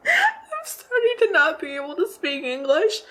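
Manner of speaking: high, giggly and tickled at herself; laughing. Real, not acted. Tipsy vlogger laughing at her garbled English, laughing. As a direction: talk through a real giggle. Laughs at own drunken slip.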